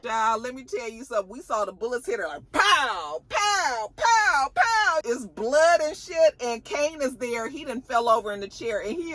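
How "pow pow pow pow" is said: high, wailed gunfire noises; imitated gunshots. Bullets hit Monet as we watch, high imitated gunshots. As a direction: stretch each pow, climbing higher.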